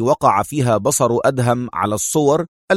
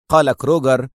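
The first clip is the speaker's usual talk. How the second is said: ordinary delivery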